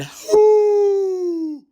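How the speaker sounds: high, loud drawn howl; a dog howling. Acted. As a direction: howl into the night. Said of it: "howled into the night".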